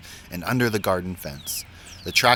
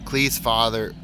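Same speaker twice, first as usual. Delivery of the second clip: usual speaking voice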